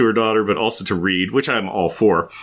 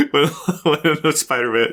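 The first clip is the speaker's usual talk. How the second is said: ordinary talk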